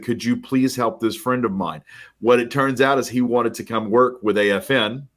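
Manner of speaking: ordinary talk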